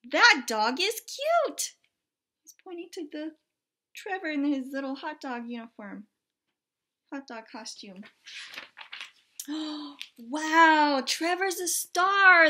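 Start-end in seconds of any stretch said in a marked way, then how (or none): 0.1-1.7 high, loud, slow admiring cry; delighted photographer. Acted. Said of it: "he said". 10.2-12.5 loud, high amazed cry. Acted. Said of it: "the photographer said".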